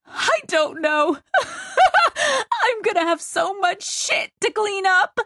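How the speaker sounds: high, hysterical laughing fit; helpless cackle spilling into speech; cackling laughter. Acted. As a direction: crack up and squeal through the words. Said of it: "She cackled".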